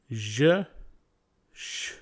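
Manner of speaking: "Je" with a voiced buzz, "sh" as pure breath; voiced and unvoiced pair. Shown as a demonstration. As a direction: voice the zh in "Je", then drop the voice for "sh". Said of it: "part of a voiced, unvoiced consonant pair".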